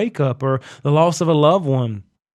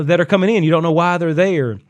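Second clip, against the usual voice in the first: normal talking voice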